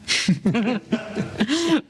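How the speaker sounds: short amused laugh; chuckle. Acted. The Dutchman laughs at his own copper joke. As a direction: laugh at your own punchline.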